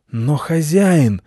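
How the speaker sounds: high, drawn-out canine protest; aggrieved guard dog; indignant dog. A narrator's reading. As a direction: protest to your master, stretching it. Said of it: "возмутился пёс".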